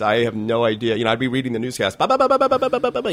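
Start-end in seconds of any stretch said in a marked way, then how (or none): 2.0-3.1 machine gun rapid-fire. Shown as a demonstration. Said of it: "like a machine gun".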